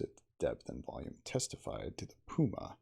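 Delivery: ordinary delivery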